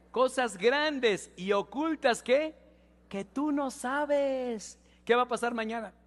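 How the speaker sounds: loud, slow, drawn-out preaching; preaching at full force. Acted. Pastor leading the church through Jeremiah, loud slow preaching. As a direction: call the verse out to the church. Leads congregation reading Jeremiah.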